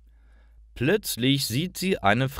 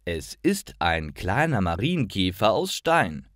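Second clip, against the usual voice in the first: ordinary delivery, nothing marked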